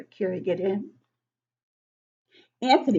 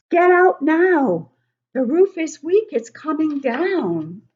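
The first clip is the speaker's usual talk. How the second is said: urgent call; 9 semitones higher, 7 dB louder than usual. Acted. The chief warning the firefighters, urgent loud high call. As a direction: order everyone out now. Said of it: "the chief calls".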